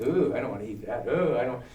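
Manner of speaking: normal talking voice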